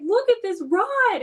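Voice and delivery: high and slow, pleading to be noticed; drawn out and insistent, pointing at the bent rod; urgent call. Retold or imitated. Woman urging her husband to look at the rod, high and slow. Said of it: "And I'm like".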